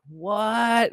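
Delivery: high, drawn-out disbelieving cry; stunned outburst, pitched well up; retold shock. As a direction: reel at terrible news. Retells hearing of brother's death.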